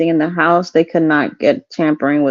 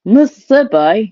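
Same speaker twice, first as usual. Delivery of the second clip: attempted Southern accent; 3 semitones higher, slower than usual. Shown as a demonstration. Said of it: "I can't do a Southern accent".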